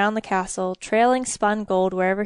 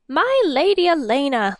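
excited greeting; 5 semitones higher, 4 dB louder than usual. A narrator's reading. King rushes to greet her.

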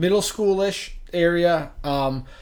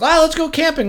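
higher, louder, keen suggestion; eager invitation; 13 semitones higher, 7 dB louder than usual. Retold or imitated. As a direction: pitch the camping trip with gusto. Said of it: "Was like".

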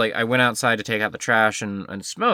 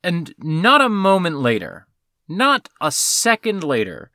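ordinary talk, nothing marked

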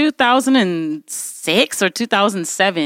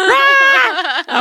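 mock rage roar. Furious outburst at Apple tech.

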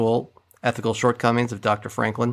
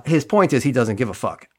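normal talking voice

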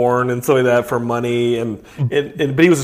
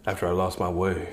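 lower, softer washed-up fighter voice; a low, hushed, self-serious fighter; Razorblade; 8 semitones lower, 10 dB quieter than usual. Acted. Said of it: "He's like".

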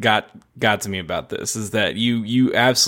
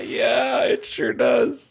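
excited shout; 11 semitones higher, slower than usual. Real, not acted. Laughing agreement at joke.

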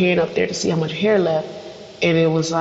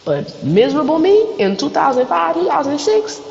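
mock misery; 10 semitones higher than usual. Mock self-pity.

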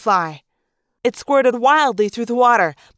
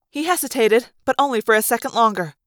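plain reading voice

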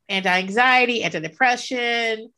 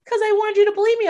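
put-on whiny kid; 11 semitones higher, much faster than usual. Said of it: "they'll be like".

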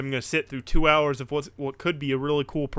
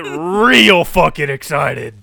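drawn-out, much louder burst of excitement; excited; 13 dB louder, slower than usual. Real, not acted. Wrestling fan recalling the lights going out, excited. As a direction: stretch it out loud with excitement. Hyped retelling of show moment.

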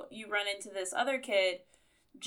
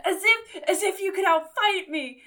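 high, loud laughing roar of a threat; dinosaur voice; 10 semitones higher, 8 dB louder than usual. Retold or imitated. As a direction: ham up a monster boast. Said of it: "he's like".

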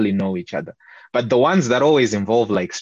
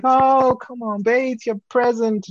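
high, coaxing mock boyfriend voice; imitating the boyfriend; 12 semitones higher than usual. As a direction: pitch it way up, coaxing his girlfriend. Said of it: "And then the dude's like".

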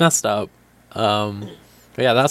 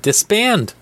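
sudden high barked command; commanding order; 10 semitones higher than usual. Retold or imitated. The Wall ordering the idols, in a high sharp command. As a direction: bark it like a decree from above. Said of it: "The Wall spit out an order that said".

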